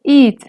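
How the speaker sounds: "id" said on a high tone. Shown as a demonstration. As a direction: say "id" with a high, level lexical tone. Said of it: "Primero con tono alto".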